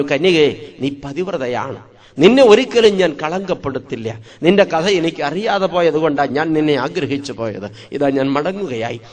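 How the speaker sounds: a lightly voiced, subdued king giving up; sorrowful retreat. A narrator's reading. Humbled Solomon answers the maiden with tears, soft and low.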